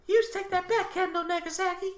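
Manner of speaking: high, breathy pop-star falsetto; squeaky [name] impression. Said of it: "Giant Haystacks actually has [name]'s voice".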